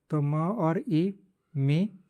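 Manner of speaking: म and ई slowly blended into मी; syllable building. Shown as a demonstration. Teacher to class, building syllables very slowly. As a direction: slowly join म and ई to say मी. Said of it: "इसको म बोलते हैं".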